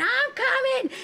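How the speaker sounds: retold screaming. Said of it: "And I'm screaming".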